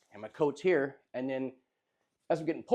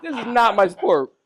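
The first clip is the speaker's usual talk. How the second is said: laughing protest; 4 semitones higher, 6 dB louder than usual. Retold or imitated. Laughing protest while dragging dude.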